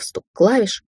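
plain reading voice

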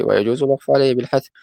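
ordinary delivery

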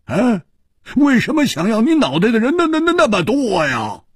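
high, teasing amazement through laughter; laugh, then marvel in a high, stuttering rush; tickled, incredulous ribbing. A narrator's reading. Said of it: "笑着说".

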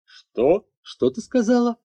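a father who can't believe his ears; indignant lord. A narrator's reading. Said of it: "возмутился повелитель".

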